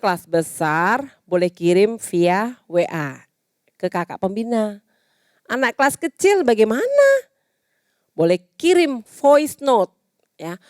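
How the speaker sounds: usual speaking voice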